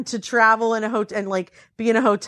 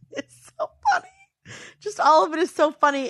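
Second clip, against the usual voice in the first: giggling through the words, pitched up; laughing; 8 semitones higher than usual. Real, not acted. Telling a friend about husband's bong, laughing through it. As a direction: laugh as you say it. Laughing at her own story.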